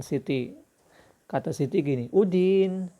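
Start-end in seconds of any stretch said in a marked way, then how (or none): 2.1-2.9 put-on high girlish call, much louder; girl's voice. Shown as a demonstration. Teacher doing a girl's voice to his pupils. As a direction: call out like a young girl. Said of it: "suara cewek".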